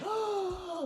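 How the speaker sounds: high, impressed mock gasp. Woman in the crowd seeing the naked rapper, mock gasp. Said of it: "any woman in the crowd going".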